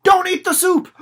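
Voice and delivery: high, loud warning shout; panicked yell. Pretend. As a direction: burst in screaming a warning. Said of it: "somebody bursts in and says".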